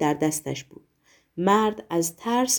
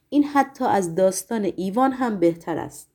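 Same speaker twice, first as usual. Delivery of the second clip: ordinary delivery, nothing marked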